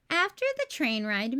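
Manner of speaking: plain reading voice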